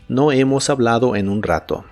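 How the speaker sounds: usual speaking voice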